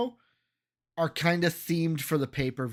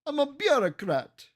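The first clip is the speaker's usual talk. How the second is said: put-on higher Jamaican-accented bureaucrat voice, pushed harder; Hermes voice; 9 semitones higher than usual. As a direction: lean into the cartoon bureaucrat's Jamaican lilt.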